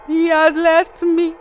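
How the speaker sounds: high, slow imitation wail; hammed-up plaintive shout; mock distressed cry. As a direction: cry it out high and drawn-out, as the jilted girlfriend. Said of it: "just to shout".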